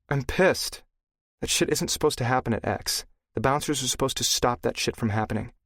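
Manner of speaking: a touch of an irritated woman; lightly voiced angry woman. A narrator's reading. Blaze angry, female voice.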